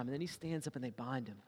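usual speaking voice

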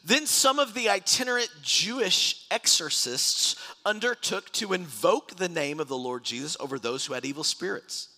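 high, loud dramatic Bible narration; scripture reading. Acted. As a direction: read the story out with preaching force. Reads scripture aloud.

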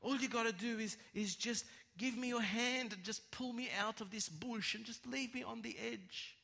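high, needy snake voice; pleading snake. Acted. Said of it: "He goes".

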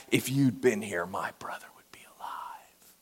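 hushed whisper of a grieving sister. Shown as a demonstration. Preacher whispering Martha's words as a possible act. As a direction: breathe it out, barely voiced. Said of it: "Was it an act".

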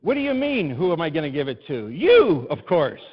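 voicing Kenny, excited. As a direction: burst out with the good news. Kenny offering the pass.

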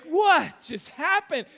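hammed-up shock after the fall; stunned disbelief. Pastor retelling falling over the box, stunned. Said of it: "and I was like".

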